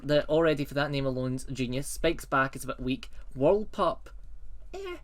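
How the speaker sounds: ordinary delivery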